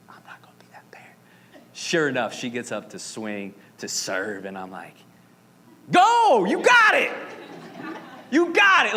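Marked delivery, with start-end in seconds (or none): 0.1-1.1 whispered under the breath, fast; whisper to self. Retold or imitated. Father at his daughter's first game whispers a promise to himself. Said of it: "I try to just". 5.9-8.9 high, loud sideline cheer; yelling cheer. Retold or imitated. Dad at volleyball game cheers his daughter's serve, loud yell. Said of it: "And I'm yelling".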